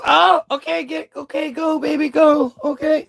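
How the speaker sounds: put-on high, half-hearted okay, go baby; mock a girlfriend giving in unwillingly; reluctant girlfriend. Girlfriend reluctantly going along.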